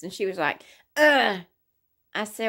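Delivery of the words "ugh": loud, higher mock "ugh"; disgusted groan. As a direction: imitate her recoiling in disgust. Acted disgusted reaction to shirt size.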